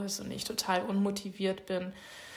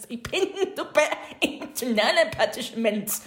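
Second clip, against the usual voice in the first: laughing while saying it; half laughing, voice lifted; 4 semitones higher, 5 dB louder than usual. Real, not acted. Redone take through laughter. (lachend gesprochen)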